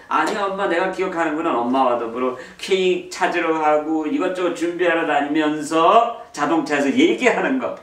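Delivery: eager daughter correcting her mom; daughter's reply. Retold or imitated. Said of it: "딸이".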